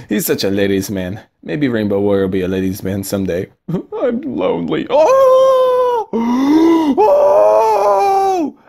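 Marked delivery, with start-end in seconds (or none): 3.7-4.9 slow, high mock-sad whine; a hammed-up lonely cry. Mock sad after laugh. 4.9-8.6 very high crying shouts and a gasp; over-the-top wrestler power-up yells; hammed-up shouts. Card power-up hype.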